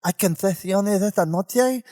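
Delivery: mock a Castilian accent, lisping the sibilants; Spaniard's lisp. Spanish pilgrim asking priest about confessions, mock Castilian lisp. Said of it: "make fun of Spaniards with their lisp".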